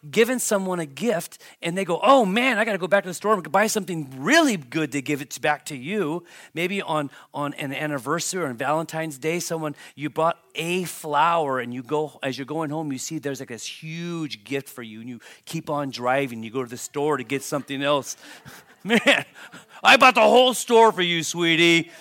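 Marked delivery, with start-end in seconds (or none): 2.0-6.2 exaggerated oh man, fast and loud; mock exasperated. Acting out gift receiver's reply. 18.8-21.9 much louder and higher, hammed-up bragging; big over-the-top brag; mock boast. Acting out spouse boasting.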